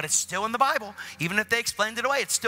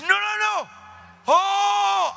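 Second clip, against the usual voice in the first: high, loud, wailing cries; overwhelmed insisting; 6 dB louder, much slower than usual. Retold or imitated. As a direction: cry out over the objection. Healed man insists, overwhelmed.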